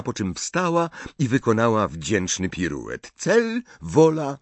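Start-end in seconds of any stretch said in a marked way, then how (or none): 3.2-4.4 high, slow, separated words; reciting brightly. A narrator's reading. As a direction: chant each word as a step. Hermiona recytuje przy piruecie. (radosna recytacja, wysoko i przeciągle)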